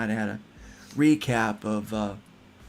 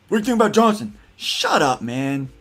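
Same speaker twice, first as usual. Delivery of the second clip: loud, high question, then a louder comeback; mock irritated exchange; 3 semitones higher, 7 dB louder than usual. Libertarian needled about his candidate, mock irritated. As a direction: act out a touchy mock retort.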